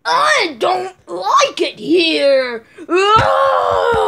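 slow loud whiny puppet then cry; goofy muffled sock puppet. Pretend. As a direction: drag it out then wail. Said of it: "I do rainbowy".

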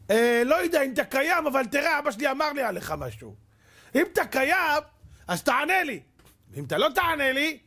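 high, bold challenge to God; cheeky prayer. Retold or imitated. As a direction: dare heaven with chutzpah. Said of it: "תקשיבו טוב איזה חוצפן". (תפילה חצופה וגבוהה)

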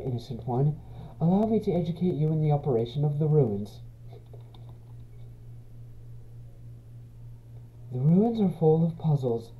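put-on soft, kindly old-fashioned goat mother; lightly voiced caretaker; gentle motherly Toriel.

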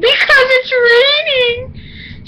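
high whine breaking into a breathless fake sob; mock scared whine. Telling mom she's scared of the rain, hammed-up whimper. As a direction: ham up being terrified of rain. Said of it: "I'm just kidding".

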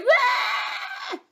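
high, hammed-up child's bawl; mock crying wail; loud fake wail. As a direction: bawl like a tantruming princess. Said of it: "and cried".

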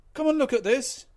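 very loud high yell; bawling. A narrator's reading. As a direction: shout urgently to the captain. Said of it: "bawled the second mate".

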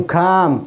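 كام with a drawn-out "aa"; long alef vowel. Shown as a demonstration. Teacher showing what come is not, long vowel. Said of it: "طبعًا مش ألف".

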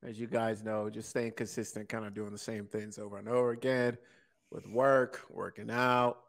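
ordinary talk

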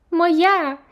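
high, loud squabbling cry; squirrel shouting. A narrator's reading. Squirrel Mary shouts at Elvira, high and loud. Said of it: "одна одній кричали".

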